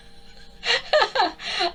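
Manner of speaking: high loud laugh. Real, not acted. Laughing at the fast-talking woman's speed. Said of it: "I'm going a bit deaf".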